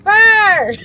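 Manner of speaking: high, loud mock roar; playful roar. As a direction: roar like a cartoon monster. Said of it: "Thank you for the roar".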